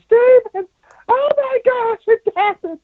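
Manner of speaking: very high, tearful aunt voice squealing the news; crying aunt. Retold or imitated. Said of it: "my aunt called me crying".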